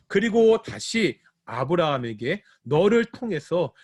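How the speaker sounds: usual speaking voice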